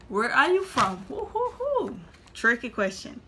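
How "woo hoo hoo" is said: high playful woo. Real, not acted. Reacting to a tricky question.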